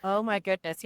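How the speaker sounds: usual speaking voice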